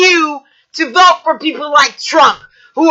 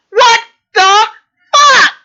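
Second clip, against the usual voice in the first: furious what the fuck; 6 semitones higher, 3 dB louder than usual. Real, not acted. Vlogger raging at Republicans, louder and higher in disbelief. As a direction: voice jumping high on fuck, louder.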